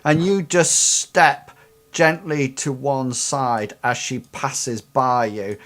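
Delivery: ordinary talk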